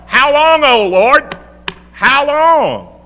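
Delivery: cranky saints crying out. Acted. Saints under the altar crying impatiently to God, loud wail. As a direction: cry out to heaven like restless saints. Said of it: "the saints are cranky".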